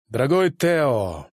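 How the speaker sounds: stern metallic voice. A narrator's reading. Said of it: "сказала она громким, строгим металлическим голосом".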